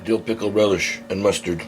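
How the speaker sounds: ordinary delivery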